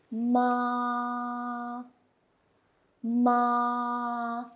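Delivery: ma held high and level; first tone. Shown as a demonstration. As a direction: keep ma high and flat. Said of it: "pronounce high and level".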